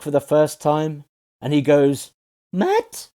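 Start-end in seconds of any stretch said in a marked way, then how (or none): 2.5-3.1 mock surprise, pitched way up and rising; high-pitched surprise. Cherry sees Daredevil unmasked for the first time, high-pitched surprise. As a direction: imitate a surprised, very high-pitched squeak of a question. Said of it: "in a high-pitched, surprised voice?".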